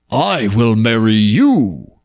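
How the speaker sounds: slow, lightly voiced earnest prince; prince proposing. A narrator's reading. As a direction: declare it, unhurried. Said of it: "said the prince".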